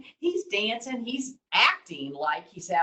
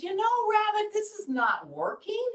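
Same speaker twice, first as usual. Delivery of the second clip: high-pitched storybook grumble; lightly acted protest; mock complaint; 13 semitones higher than usual. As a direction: play a doubting eagle, voice pitched well up. Eagle complains the trick fails.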